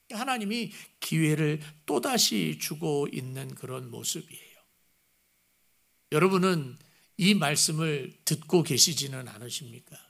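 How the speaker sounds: usual speaking voice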